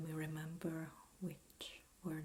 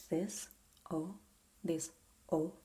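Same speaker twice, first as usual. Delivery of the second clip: usual speaking voice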